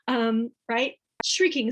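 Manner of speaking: usual speaking voice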